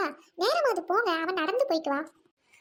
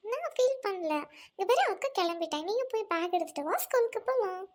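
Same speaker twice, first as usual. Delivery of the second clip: ordinary talk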